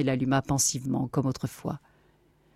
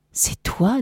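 higher-pitched disbelieving question; astonished question; 7 semitones higher than usual. Acted. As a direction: ask in rising amazement. Captain meets long-lost brother.

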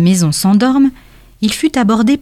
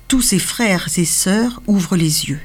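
usual speaking voice

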